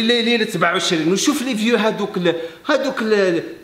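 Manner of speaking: ordinary talk, nothing marked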